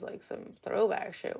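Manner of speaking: ordinary talk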